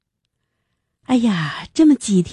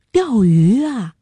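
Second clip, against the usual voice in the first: put-on mildly excited, drawn-out boyish question; 5 semitones higher, slower than usual. A narrator's reading. Young boy hearing about a fishing trip, excited. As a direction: perk up like a child offered a treat. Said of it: "小男孩的眼睛都亮了".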